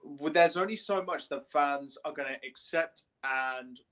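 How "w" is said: ordinary delivery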